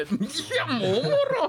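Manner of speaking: high words breaking into laughter; laughing. Retold or imitated. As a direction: laugh as you say it. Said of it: "笑いこらえながら".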